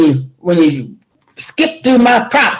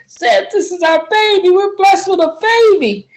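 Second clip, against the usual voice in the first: much higher, tender cooing; tender joy; 12 semitones higher than usual. Acted. Sarah rocks the baby and tells Zed, tender. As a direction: coo over the new baby.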